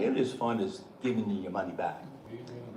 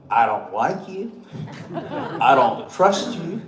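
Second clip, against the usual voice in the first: laughing through quote; 7 dB louder, slower than usual. Retold or imitated. Quoting the gruff man, laughing.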